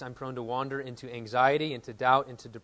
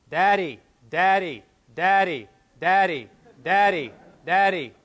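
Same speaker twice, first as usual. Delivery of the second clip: higher, loud sing-song Daddy calls; insistent calling; 6 semitones higher, 14 dB louder, slower than usual. Retold or imitated. Boy calling his dad again after a pause, higher and louder. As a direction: call Daddy like a child growing impatient.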